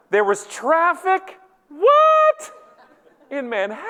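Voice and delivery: hammed-up, high-pitched incredulity; mock disbelief. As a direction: feign utter shock at a feeble excuse. Mocking a line-cutter's excuse.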